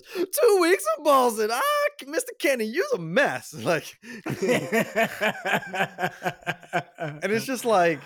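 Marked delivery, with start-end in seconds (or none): 0.0-3.5 hammed-up scandalized squeal; Steve. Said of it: "And then Steve goes".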